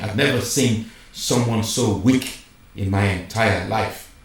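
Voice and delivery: ordinary talk, nothing marked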